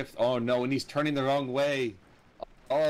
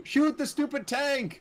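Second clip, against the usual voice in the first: yelling; 11 semitones higher, slower than usual. Real, not acted. Frustrated cheering at the game.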